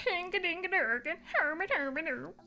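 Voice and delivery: high, sing-song mock Swedish chef gibberish. Streamer naming her pet in a Swedish chef voice. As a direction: bounce it like the Muppets' Swedish chef. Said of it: "Close to the Swedish chef".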